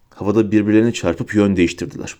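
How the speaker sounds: ordinary delivery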